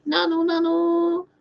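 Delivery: high, slow mock-angelic voice; mocking angel channeler. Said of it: "and they're like".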